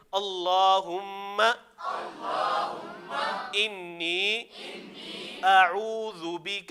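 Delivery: very slow, drawn-out Arabic supplication; measured teaching chant, phrase by phrase; repeat-after-me recitation. Shown as a demonstration. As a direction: stretch each phrase for students to repeat. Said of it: "میرے ساتھ پڑھیں گے".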